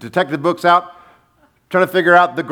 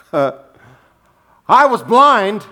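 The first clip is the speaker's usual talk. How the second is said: slow, raised, drawn-out proclamation; emphatic testimony; 7 semitones higher, 5 dB louder, much slower than usual. Retold or imitated. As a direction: declare it slowly with punch, acting the healed man. Healed man proclaims his one certainty.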